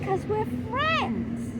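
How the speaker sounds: high, slow, drawn-out exasperation; exasperated repeat. Retold or imitated. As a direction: spell out "friends" as if it were obvious. Her exasperated retold reply.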